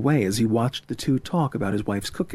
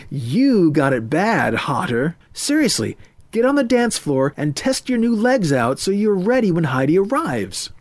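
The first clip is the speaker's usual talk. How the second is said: teasing hepcat friend; 6 semitones higher, 4 dB louder than usual. Acted. Friend sends Murray to the dance floor, teasing. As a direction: tease him, then push him onward, pitch rising. Ethan teases and urges friend.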